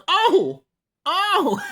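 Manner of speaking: loud, high whooping "oh"; hammed-up reaction. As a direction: hoot as if at a great comeback. Mock reaction to his comeback.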